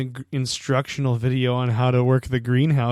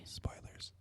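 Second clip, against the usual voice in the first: whisper; 24 dB quieter than usual. Pretend. Mock secret warning.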